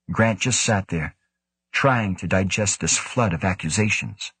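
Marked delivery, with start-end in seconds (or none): none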